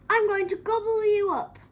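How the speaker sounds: high, loud menacing troll roar; bellowed storybook threat. A narrator's reading. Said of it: "roared the troll".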